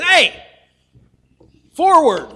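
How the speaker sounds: high, loud irritated yell at the donkey; angry shout. Retold or imitated. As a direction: bark the order at a stubborn animal. Balaam whacks the irritated donkey.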